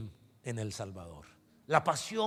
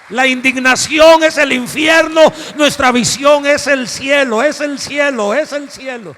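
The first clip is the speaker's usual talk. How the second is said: much higher, very loud, slow preaching shout; full preaching shout; 9 semitones higher, 19 dB louder, slower than usual. Real, not acted. Preacher to the congregation at the climax, full shout. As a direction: shout the climax to the whole church.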